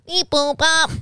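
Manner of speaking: beeping robot-like alien sounds; goofy beep-boop alien. Pretend. Acting out the alien's reply.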